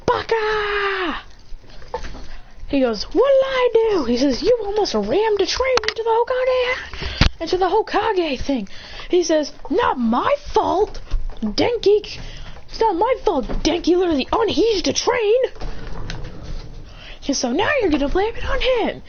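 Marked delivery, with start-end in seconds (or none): 0.1-1.2 high, drawn-out "Baka!"; mock yell from across the house; acted scolding cry. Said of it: "all he hears is". 3.0-4.0 high, drawn-out acted protest; whiny protest. Scolded character protests. 4.4-8.7 high, drawn-out mock-indignant scolding; acted scolding. Parent scolds child at home. 9.7-19.0 high-pitched indignant back-and-forth; acted argument. Quarrel over blame at home.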